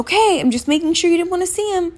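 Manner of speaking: high, fast, breezy doctor voice; mock chirpy doctor. Patient mimics the doctor brushing her off, high and fast.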